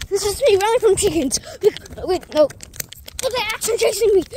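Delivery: fast, high, rising panic; panicked. Real, not acted. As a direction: flee in a fluster. Chased by chickens.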